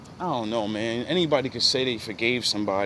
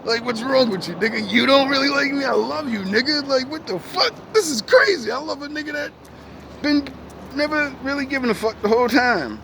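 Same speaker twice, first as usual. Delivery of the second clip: confronting a friend who never cared; hurt disbelief; 11 semitones higher than usual. Retold or imitated. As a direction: pitch up in wounded outrage.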